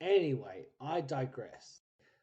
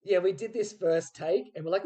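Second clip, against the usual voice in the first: ordinary talk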